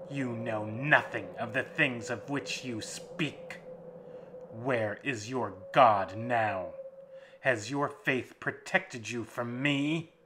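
vampire snarls at the captive's faith, loud; snarling vampire. Acted. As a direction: tear his faith apart. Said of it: "the master snarled".

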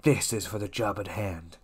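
low, hushed mock villain voice; softly voiced cartoon conspirator handing over a job; shady deal. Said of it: "Mars Girl slides some money across".

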